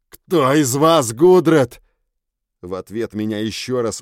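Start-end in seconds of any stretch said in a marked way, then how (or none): 0.1-1.8 loud, slow, defiant question. A narrator's reading. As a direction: demand it loud through the pain. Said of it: "снова громко спросил".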